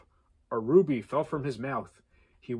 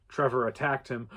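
ordinary delivery

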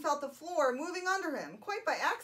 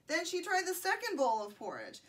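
plain reading voice

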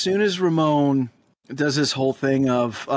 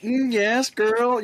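sassy; 7 semitones higher, much slower than usual. Acted. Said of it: "to be like".

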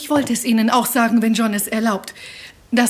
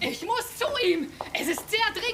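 much higher and much softer, anxious; anxious urgency; 9 semitones higher, 9 dB quieter than usual. Acted. Wife begs the housekeeper to see the doctor, anxious and urgent. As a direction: plead breathlessly to be let in.

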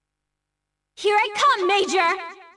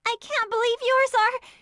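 usual speaking voice

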